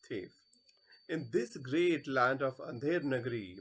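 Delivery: ordinary delivery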